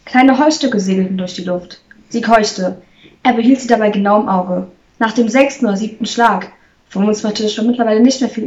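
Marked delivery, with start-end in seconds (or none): none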